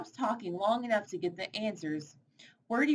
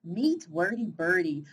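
ordinary delivery, nothing marked

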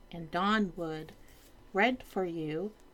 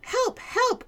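high frantic cry for help; panicked page cry; 4 dB louder than usual. Acted. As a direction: yell for help, pitched way up. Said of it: "cried the court".

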